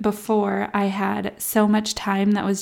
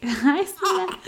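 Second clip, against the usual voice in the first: much higher small voice; small plea; 10 semitones higher than usual. Retold or imitated. Mom imitating herself wanting to be seen, high small voice. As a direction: ask to be noticed, meekly. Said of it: "you're like".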